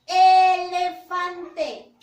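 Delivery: very slow, loud model of elefante; slow loud model word. Shown as a demonstration. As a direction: belt out elefante syllable by syllable. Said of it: "¿Inicia o no inicia con la vocal E?".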